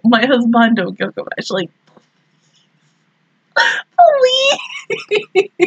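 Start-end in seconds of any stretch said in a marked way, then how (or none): 4.0-5.6 high, laugh-cry of a please; wailing please through a laugh. Real, not acted. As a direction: beg with a half-laugh, half-sob. Begging show not to kill Gilgamesh.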